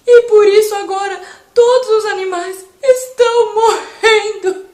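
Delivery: loud, high, wailing lion; distressed lion. Acted. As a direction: sob the bad news as the lion. Lion tells of the monster.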